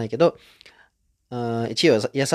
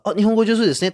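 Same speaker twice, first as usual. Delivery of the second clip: quoted compliment; 9 semitones higher, 5 dB louder, faster than usual. Retold or imitated. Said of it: "とか言わないですよ".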